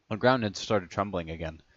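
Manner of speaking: ordinary delivery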